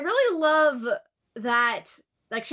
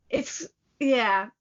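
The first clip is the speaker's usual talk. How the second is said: ordinary talk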